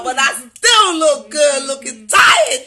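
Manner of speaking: slow, loud proud shout; loud boast. Real, not acted. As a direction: belt it out with pride. Loud boast, big laugh.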